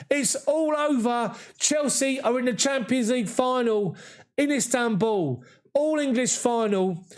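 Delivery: a fan screaming with joy as the final whistle goes; ecstatic. Real, not acted. Live reaction to Chelsea reaching final.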